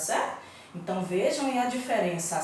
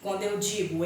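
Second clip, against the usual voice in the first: ordinary delivery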